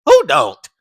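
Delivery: loud, fast, disbelieving rant; exasperated rant. Retold or imitated. Ranting about everyone beefing with Khalil, loud and fast. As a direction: fire off the questions quickly. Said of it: "And I was like".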